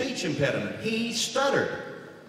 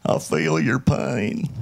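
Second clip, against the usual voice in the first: slow husky Southern drawl; Clinton impression; 3 semitones lower, slower than usual. President sympathizing, slow Southern drawl. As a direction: lean in and drawl it. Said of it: "his early catchphrase was".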